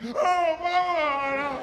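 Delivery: high, loud hammed-up wail; mock wailing cry. Comic imitating the ugly cry he fears, high loud wail.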